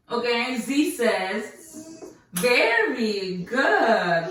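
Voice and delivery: very slow, stretched words; slow drawn-out praise. Real, not acted. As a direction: draw each word out slowly.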